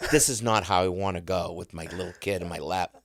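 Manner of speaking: usual speaking voice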